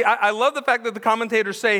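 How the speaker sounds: ordinary talk, nothing marked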